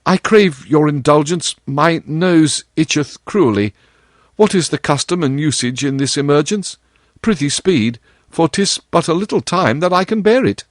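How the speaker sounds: genuine anguish. Acted. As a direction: beg for help, anguished and urgent. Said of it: "Tom said with genuine anguish".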